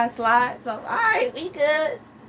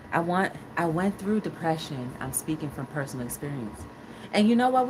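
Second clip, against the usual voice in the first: ordinary delivery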